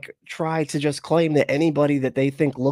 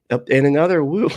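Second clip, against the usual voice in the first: ordinary talk, nothing marked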